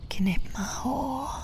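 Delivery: a slow whispered demand to a lover. Acted. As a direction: breathe it out, slow and intimate. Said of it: "hvisker jeg".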